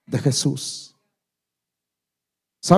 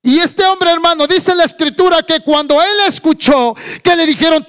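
high, loud preacher's voice; full-force preaching; 10 semitones higher, 5 dB louder than usual. Acted. Preacher retells the blind man hearing the call, full-force preaching. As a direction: launch into high, forceful proclamation.

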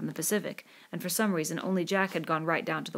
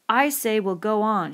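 loud, slow, higher-pitched command; furious shout; 4 semitones higher, 7 dB louder, slower than usual. A narrator's reading. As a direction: insist furiously, weighting each word. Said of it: "shouted Ralph furiously".

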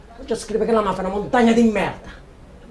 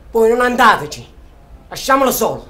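raised voice; 3 semitones higher, 8 dB louder than usual. Acted. Defiant radio speech against mafia.

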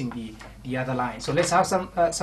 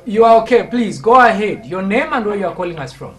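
loud, raised-pitch reassurance down the line; calling out; 6 semitones higher, 12 dB louder than usual. Real, not acted. Host telling a hesitant caller to go ahead, calling out. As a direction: project hard to reach the caller.

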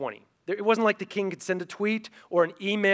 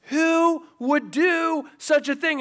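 high, loud and slow demand; furious king; 10 semitones higher, 4 dB louder, much slower than usual. Retold or imitated. King asking Esther who plotted, slow loud outrage. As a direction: drag out each word in fury.

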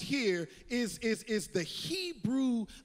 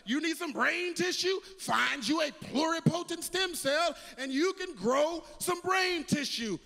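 higher, rising preaching refrain; building preaching; 5 semitones higher than usual. Acted. Preacher driving home his stem cell image, rising. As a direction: build each question like a drumbeat.

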